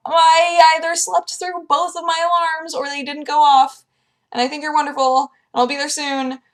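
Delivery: high, loud, rushed apology; frantic apology. Retold or imitated. Vlogger replaying her panicked apology call to producer. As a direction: gush sorry at the producer, hammed up. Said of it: "I was like".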